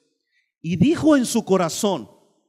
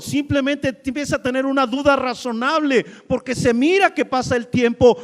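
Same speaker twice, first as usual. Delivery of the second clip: normal talking voice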